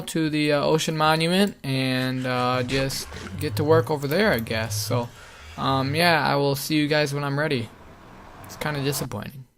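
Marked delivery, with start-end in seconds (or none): none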